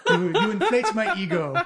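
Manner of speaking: loud flattered laughing. Acted. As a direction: laugh through the thanks. NPC flattered, laughing loudly.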